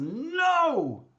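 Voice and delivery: sharp shouted no, pitched high; retold scream. Stranger on the street refusing tefillin, shouting no. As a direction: bark the refusal the way the stranger did. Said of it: "See, he screamed at me".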